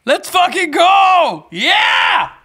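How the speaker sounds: high, loud roar drawn out slowly; triumphant shout. Real, not acted. Streamer cheering the follow goal, triumphant shout. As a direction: bellow it in real triumph.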